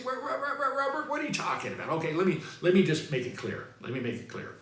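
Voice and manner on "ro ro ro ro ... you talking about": stammering doubter. Retold or imitated. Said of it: "So you say".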